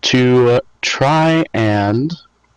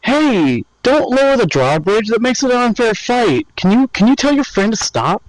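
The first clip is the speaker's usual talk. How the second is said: pleading yell; 11 semitones higher than usual. Acted. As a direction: yell across to them in protest, pitched way up. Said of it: "I yell to it".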